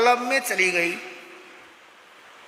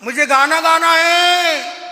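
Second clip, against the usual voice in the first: put-on slow child's plea; 5 semitones higher, 5 dB louder, slower than usual. Child wakes father at midnight.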